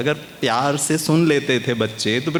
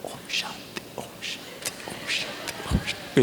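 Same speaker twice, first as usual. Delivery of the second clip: soft whispered chant, much softer; whispered om shanti; 9 dB quieter than usual. Retold or imitated. Mother whispers om shanti to her son, softly whispered. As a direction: breathe the mantra out as a hush. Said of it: "बड़े प्यार से सुना रही है mummy".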